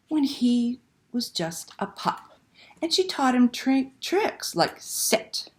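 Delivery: slow, lively picture book reading; reading to children. Acted. Librarian reading Una to children online, expressive storytelling. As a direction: read it like a bedtime story to little ones.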